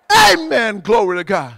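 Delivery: loud, high-pitched shout of praise; exultant shout. Real, not acted. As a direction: shout it out to the whole congregation. Preacher exults to congregation.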